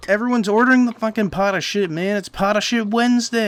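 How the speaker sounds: defensive cartoon cook. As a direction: shout back at the boss in defense. Cook yelling back defensively.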